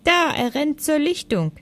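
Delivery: put-on high child voice, calling; acted little-kid exclamation; child's voice. A narrator's reading. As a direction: point it out excitedly as a child. Child keeps calling to father.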